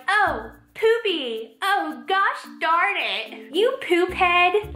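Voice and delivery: put-on high, slow, babyish prim voice; mock a child scolding with baby words; hammed-up toddler voice saying poopy; childish mock swearing. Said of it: "they're like children".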